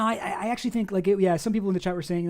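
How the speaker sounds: ordinary delivery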